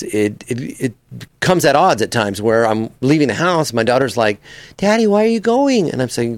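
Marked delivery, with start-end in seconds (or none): 4.8-5.9 put-on high child's voice pleading; little kid asking daddy; little daughter. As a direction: ask like a small girl.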